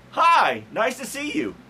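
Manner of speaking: high, bright fake greeting; fake cheerful. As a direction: greet insincerely. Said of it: "Opposite Day".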